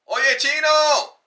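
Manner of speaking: loud, high, drawn-out street holler; calling out. Acted. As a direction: holler it out to someone across the street.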